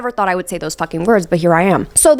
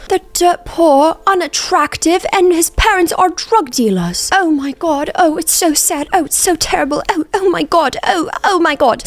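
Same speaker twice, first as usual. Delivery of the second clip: much higher, gushing fake sympathy; mock pity gossip; 8 semitones higher than usual. Family gossiping about poor Oliver, gushing fake pity. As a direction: gasp over him like a pitying aunt. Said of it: "they're all, like, shit-talking Oliver".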